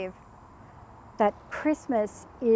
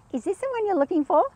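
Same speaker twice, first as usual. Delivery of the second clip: quick, high-pitched imitation of the flatmate; high rising mock-innocent question; imitated flatmate; 8 semitones higher, faster than usual. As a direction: ask it high and fast, rising at the end. Voices flatmate holding chewed shoe.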